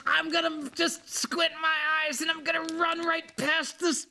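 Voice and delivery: high squeaky squirrel voice. Retold or imitated. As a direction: panicky little squirrel psyching himself up.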